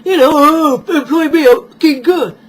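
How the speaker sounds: loud, dim-witted interview voice; loudly mocking a hopeless interviewee; mock inarticulate applicant. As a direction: ham up a clueless job seeker. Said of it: "sat at the interview going".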